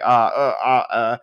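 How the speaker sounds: mock tongue-tied stammer; imitating being flustered by confrontation; jumbled words. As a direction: stumble over empty filler sounds. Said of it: "jumbled my words when it came to".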